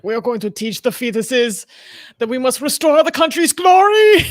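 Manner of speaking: put-on German accent building to a shout. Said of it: "I can't do a German accent".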